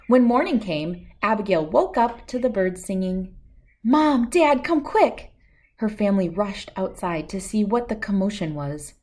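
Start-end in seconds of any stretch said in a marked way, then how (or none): 3.8-5.3 put-on high, urgent child calling out; excited call. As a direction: call them out to see. Abigail calling parents outside.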